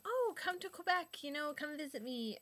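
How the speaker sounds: high-pitched, eager coaxing; eager invitation. Retold or imitated. Inviting someone to come visit Quebec, eager and high. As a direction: act out an enthusiastic invite.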